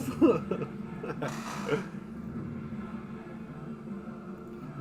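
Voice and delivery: long laugh trailing off softly; real laugh, fading away. Real, not acted. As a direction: let out a drawn-out laugh. Laughs at own German remark.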